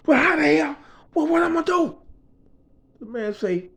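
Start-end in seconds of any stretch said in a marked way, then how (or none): 0.1-2.0 high-pitched outburst of frustration; exasperated. Retold or imitated. Boss hears nobody works weekends, exasperated. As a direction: act out a boss at a loss.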